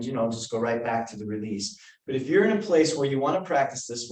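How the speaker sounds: ordinary talk, nothing marked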